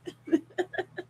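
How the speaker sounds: a high, breathy burst of real laughter. Real, not acted. Recalling the rant, a high burst of laughter. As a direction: let the giggle escape high. Laughing at her own story.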